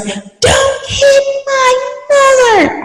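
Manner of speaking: high, tearful cry of a frightened daughter; crying plea. Retold or imitated. Daughter begging her not to hit her mother, crying. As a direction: plead through tears, voice cracking high.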